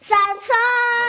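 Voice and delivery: loud, high drawn-out holler; high, stretched shout of a name; mother's call. Acted. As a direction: call out the name as if across a market. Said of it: "cómo le grita a su mamá".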